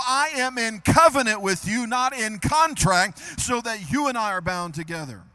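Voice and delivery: loud, climactic preaching cadence; preaching at full force. Acted. Pastor preaching loud to his congregation about covenant. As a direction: rise to a shout of assurance.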